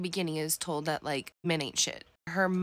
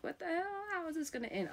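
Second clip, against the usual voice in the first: pitched way up in disbelief; a reader's high, disbelieving outburst at a twist; shocked disbelief; 10 semitones higher, 6 dB quieter, faster than usual. Retold or imitated. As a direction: squeak out a stunned what the hell. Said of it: "I'm like".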